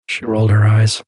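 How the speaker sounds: ordinary delivery, nothing marked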